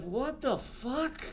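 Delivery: slow, hushed what the fuck; stunned disbelief. Retold or imitated. Someone looking back on history, slow hushed disbelief. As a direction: let it hang, dumbfounded. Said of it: "you're like".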